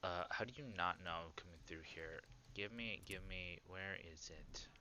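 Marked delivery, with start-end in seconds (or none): 1.3-4.7 soft whispered question. Real, not acted. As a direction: hush it while searching. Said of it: "whispering while searching".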